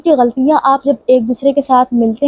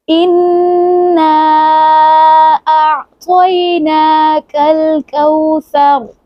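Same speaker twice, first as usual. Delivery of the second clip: tajweed recitation; 3 semitones higher, much slower than usual. Shown as a demonstration. Teacher leads practice recitation, slow and chanted. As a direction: intone each syllable long and melodic. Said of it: "फिर से practice करते हैं".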